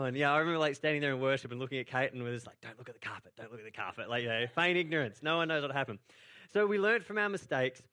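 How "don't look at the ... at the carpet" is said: casi susurrando, entre dientes; hushed aside. Retold or imitated. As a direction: como un secreto que nadie debe oír. Hushed warning at worship.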